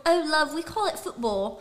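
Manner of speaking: slow, put-on British accent. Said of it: "I was from the U K".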